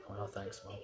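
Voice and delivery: normal talking voice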